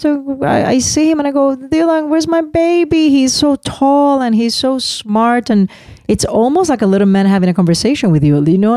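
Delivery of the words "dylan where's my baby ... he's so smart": high, drawn-out motherly cooing; doting mother. Retold or imitated. Mother lamenting her baby has grown, high doting coo. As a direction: fuss over your growing child in a high voice. Mother gushing over growing son.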